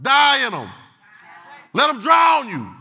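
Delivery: preaching at full force. Acted. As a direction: lift it up and cry it out. Preacher urging the congregation.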